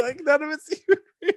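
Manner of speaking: high, half-crying laugh. Real, not acted. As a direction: laugh till it squeaks. Laughing at plot.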